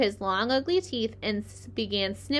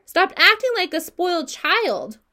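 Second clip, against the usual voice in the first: high, loud angry scolding; scolding shout; 8 semitones higher, 6 dB louder than usual. A narrator's reading. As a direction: scold him like a spoiled child. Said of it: "screamed Wilbur".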